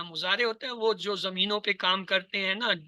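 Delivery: ordinary delivery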